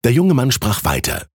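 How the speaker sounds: plain reading voice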